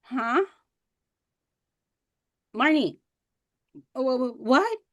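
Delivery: acted dazed, stammering response; mock distracted confusion; unfocused. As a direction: play zoned out, then startled into answering. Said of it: "Poor focus. They're talking to you and you're like".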